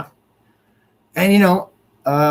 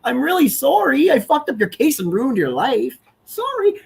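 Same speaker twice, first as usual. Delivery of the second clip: higher, whiny fake sorry; sneering, put-on remorse; whiny mocking apology; 8 semitones higher than usual. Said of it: "you don't get to say".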